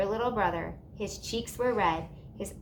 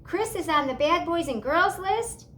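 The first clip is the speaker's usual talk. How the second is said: dismayed cry; 9 semitones higher, 4 dB louder than usual. A narrator's reading. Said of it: "she cried".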